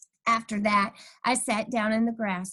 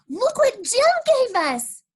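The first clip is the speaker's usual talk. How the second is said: put-on high, loud eager kid voice; excited bragging; 7 dB louder than usual. A narrator's reading. Lucille bragging to Junie B, high and eager. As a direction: wave the invitation in her face.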